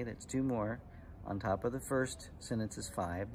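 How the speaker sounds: ordinary delivery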